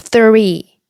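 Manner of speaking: "three" with tongue between the teeth for TH; TH pronunciation demo. Shown as a demonstration. Teacher showing Korean learners the TH, careful TH pronunciation. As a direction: say "three" with a clear TH. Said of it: "티에이치 발음 있잖아요".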